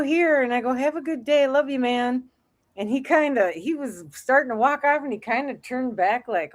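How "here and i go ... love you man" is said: high, bright-hearted well-wishing to a stranger; fond send-off. Retold or imitated. To a homeless man, handing him cash: higher, hearty goodwill. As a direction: hand it over with a big friendly "love you, man".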